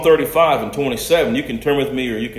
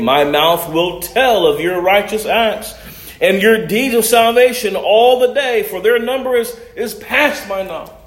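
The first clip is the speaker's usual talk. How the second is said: raised, ringing pulpit reading of scripture; 9 semitones higher, 6 dB louder than usual. Acted. Preacher reading John chapter seven aloud to church, proclaiming. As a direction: proclaim the passage to the congregation.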